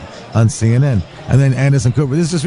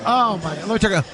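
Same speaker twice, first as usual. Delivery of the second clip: ordinary talk, nothing marked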